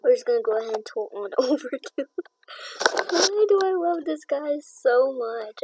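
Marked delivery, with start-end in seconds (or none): 2.9-5.6 gushing. Real, not acted. Overwhelmed love for horse.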